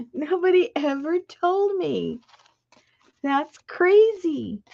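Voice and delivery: high excited disbelief; amazed. Real, not acted. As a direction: marvel that nobody said so. Still marveling at trick.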